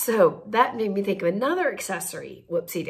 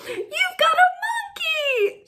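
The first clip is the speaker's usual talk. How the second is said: excited calling out; slower than usual. Retold or imitated. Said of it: "screaming for a block".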